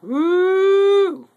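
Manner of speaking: high excited whoop; excited woo. Real, not acted. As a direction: let out a joyful whoop. Cheer at the box contents.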